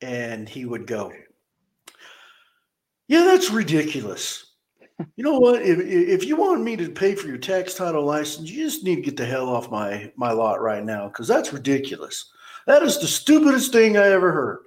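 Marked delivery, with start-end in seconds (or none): 3.1-14.6 mock angry manager. Manager telling customers to get off his lot, scornful yell. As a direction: rant at them like the rude boss.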